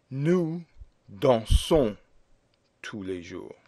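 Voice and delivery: teacher gives example sentence for the homework; model French sentence. Shown as a demonstration. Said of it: "You would say".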